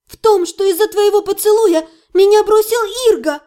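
high, loud outburst; angry accusation. A narrator's reading. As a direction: throw the blame at him, high and loud. Heroine accuses orc in quarrel.

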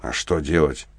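low, soft, controlled question; as cool as possible. A narrator's reading. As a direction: ask with restrained composure. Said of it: "как можно хладнокровнее спрашиваю я". (хладнокровно, негромко и низко)

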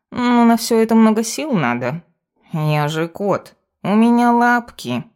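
lilting cat voice playing helpless; self-important cat familiar. Cat lists its services.

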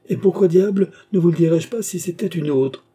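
loud, high indignant protest; exasperated. Acted. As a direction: burst out in exasperation. Doctor exasperated in acted dialogue.

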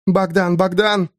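Eva's voice. A narrator's reading. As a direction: call him from inside his head. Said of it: "голос Евы".

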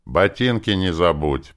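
mild yell at an underling; sharp shout. A narrator's reading. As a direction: call it out as a curt command. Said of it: "прикрикнул другой маг".

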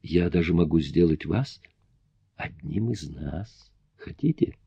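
вкрадчиво, переходя в шёпот; медленно, тихо, соблазняя; insinuating whisper. A narrator's reading. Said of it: "вкратчиво добавил". (вкрадчиво)

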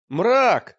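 loud, high cry to a companion; shouted call. A narrator's reading. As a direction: call out loudly for attention. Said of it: "закричал Олег". (громкий оклик)

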